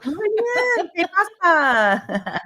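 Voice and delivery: high, put-on Spanish greeting; playful Spanish. High mock-Spanish greeting for the co-reader. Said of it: "break out in different languages".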